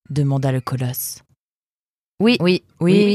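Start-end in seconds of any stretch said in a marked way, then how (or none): 2.2-3.2 eager higher-pitched run of yeses; higher, overlapping chorus of yeses; prisoners answering in chorus. A narrator's reading. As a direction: answer like several prisoners piping up in turn. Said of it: "répondirent en chœur les prisonniers".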